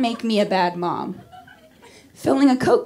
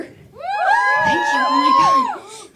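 high, shouted fake overwhelm; mock gushing thanks; much slower than usual. Comic thanking the crowd, high hammed-up gushing. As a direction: gush like winning an award.

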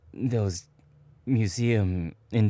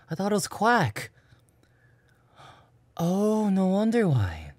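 embarrassed realization; 10 semitones higher than usual. Real, not acted. Realizing he misheard it.

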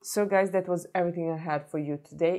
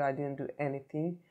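ordinary talk

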